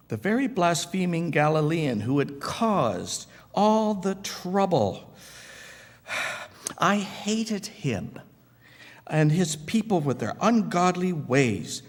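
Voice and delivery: high, strained indignation; burning anger. Acted. Former persecutor recalls the Galilean he hated, high and angry. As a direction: pitch it high with pent-up resentment. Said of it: "my anger burned so brightly".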